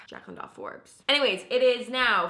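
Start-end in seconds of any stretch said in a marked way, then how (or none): 0.1-1.0 soft whisper of the handle. Real, not acted. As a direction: breathe the name out.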